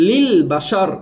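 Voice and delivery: লিল বাসার ending on a bare r; stopped ending. Shown as a demonstration. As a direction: stop লিল বাসার on r with no vowel after. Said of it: "শেষের হরফটা যোজম হয়ে যাবে". (থেমে শেষে যোজম দিয়ে লিল বাসার)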